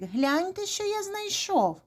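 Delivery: high, swooping call; excited calling. A narrator's reading. Rooster shows mice what he found, calling excitedly. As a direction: show off a find to friends. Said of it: "покликав півник".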